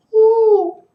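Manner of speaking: a sudden loud, high-pitched whoop of joy; loud woo. Real, not acted. Fan whooping after nearly saying Super Bowl, loud joyful whoop. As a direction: let out a big cheering woo.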